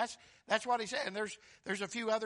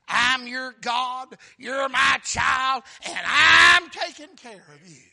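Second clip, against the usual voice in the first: slow, very loud shouted promise; slow preaching shout; 5 semitones higher, 17 dB louder, slower than usual. Acted. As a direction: pound out God's promise.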